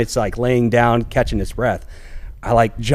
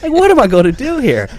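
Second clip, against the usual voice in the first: laughing it out, high and louder; 12 semitones higher, 5 dB louder than usual. Real, not acted. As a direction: laugh through the disbelief. Laughing at his own disbelief.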